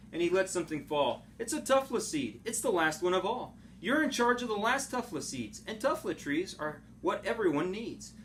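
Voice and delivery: ordinary delivery, nothing marked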